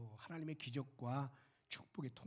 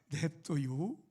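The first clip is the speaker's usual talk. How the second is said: cheeky reply; 4 semitones higher, 5 dB louder than usual. Retold or imitated. Daughter-in-law's cheeky reply.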